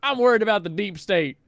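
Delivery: high, loud mock Camelot accent. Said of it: "I have to say it in that accent".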